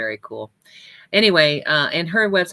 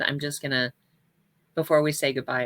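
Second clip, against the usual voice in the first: usual speaking voice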